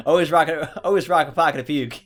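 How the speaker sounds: loud movie quote. Retold or imitated. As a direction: shout the quote out. Quoting movie line.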